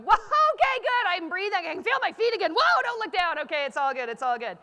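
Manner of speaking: mock panic talking yourself down; jittery pep talk that gradually calms; nervous self-talk. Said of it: "still talking to myself going".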